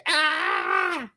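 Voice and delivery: contained mock shriek; losing-it scream. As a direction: re-enact a freak-out scream. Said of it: "losing my l-, shit, dude. Being like".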